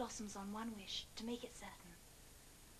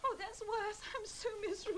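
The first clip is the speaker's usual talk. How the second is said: high, upset wail; miserable, pitched well up; tearful misery; 8 semitones higher, 3 dB louder than usual. Acted. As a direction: wail it as if near tears. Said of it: "Why are you crying".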